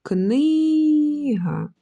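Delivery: "книга" called high, with "кни" held long; high sustained calling tone on "кни" in "книга". Shown as a demonstration. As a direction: call "книга", stretching the first syllable. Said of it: "Кличемо".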